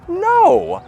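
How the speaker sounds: insist, rising in pitch and volume; retorting. Retold or imitated. Speaker replies to people calling him Scandinavian, protesting. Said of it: "And I'm like".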